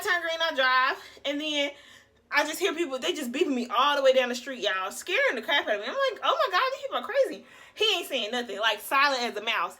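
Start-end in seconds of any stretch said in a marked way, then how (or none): 6.2-7.5 freaked out. Retold or imitated. Scared by honking drivers.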